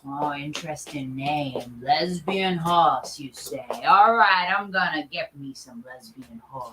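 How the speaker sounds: a greedy boss savoring his plan; scheming CEO. Acted. CEO character musing low.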